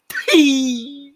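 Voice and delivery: sudden high squeaky "Pee" out of nowhere; random squeaky "Pee". Retold or imitated. Partner blurting at him on the couch, high squeak. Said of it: "I hear him go".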